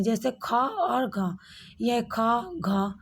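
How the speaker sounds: ख and घ said very slowly with a strong breath; slow aspirated letters. Shown as a demonstration. Teacher shows students the aspirated letters, very slowly. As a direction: give ख, घ slowly and with a full breath. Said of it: "दूसरा और चौथा वर्ग महाप्राण".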